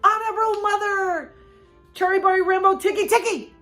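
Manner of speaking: high, loud gasping call; breathless high call. A narrator's reading. Boy gasping the name at mother, high call. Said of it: "he gasped".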